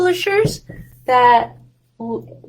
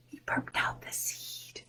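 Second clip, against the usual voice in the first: secret-telling whisper; 20 dB quieter than usual. A narrator's reading. Teacher tells the kids what happened, in a hushed whisper. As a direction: lean in and whisper it.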